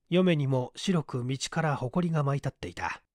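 usual speaking voice

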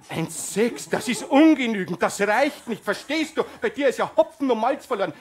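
mother berating her son; scolding mother. Acted. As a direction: tell the failing son off.

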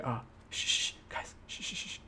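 whispered shh to the audience; hushed shushing. Real, not acted. Gamer shushing his viewers in a whisper. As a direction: hush them so the mobs don't hear.

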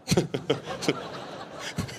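breathy laugh. Real, not acted. Laughs after joke.

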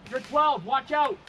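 loud, high warning yell; urgent warning shout. Real, not acted. Warning teammate of enemy ahead.

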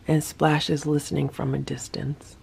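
soft unvoiced whisper; hushed aside about the listening dog. Real, not acted. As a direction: whisper it so Splash won't hear.

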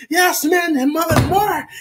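high, eager host voice; lightly voiced generous host; imitating the restaurant guy. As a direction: pitch up and push the food on him.

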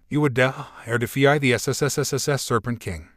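ordinary delivery, nothing marked

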